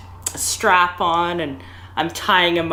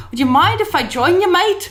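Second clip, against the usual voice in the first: mock British accent, pushy and tipsy; 12 semitones higher than usual. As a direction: ham up a drunk Brit asking in. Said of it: "this British accent".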